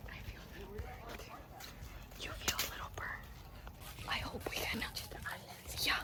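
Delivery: soft, breath-only whisper to viewers. Real, not acted. Vlogger hoping to find a raccoon for the Cheetos, whispering. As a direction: murmur it under your breath, no voice. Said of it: "we're whispering".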